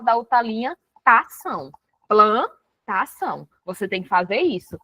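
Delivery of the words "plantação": "Plantação" slowly broken as plan... tação; split at line break. Shown as a demonstration. Teacher showing a line break, "Plantação" slowly split after plan. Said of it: "só deu pra botar plan".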